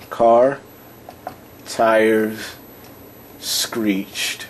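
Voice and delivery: isolated words with long gaps; slow dictation. Real, not acted. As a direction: say each word as you write it. Writing the example out as spoken.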